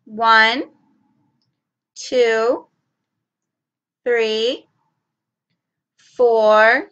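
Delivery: teacher counting with the class; counting aloud. Shown as a demonstration. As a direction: count out each number clearly. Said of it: "Let's do that again".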